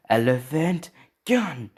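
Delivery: high, loud, drawn-out attack call; battle yell. Acted. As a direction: stretch out the move name as you yell it. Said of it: "you hear him yell".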